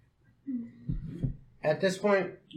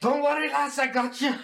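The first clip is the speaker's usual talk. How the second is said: higher-pitched dwarf reassurance; 8 semitones higher than usual. Acted. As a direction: reassure her as a stock fantasy dwarf. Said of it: "It's the stereotypical dwarf".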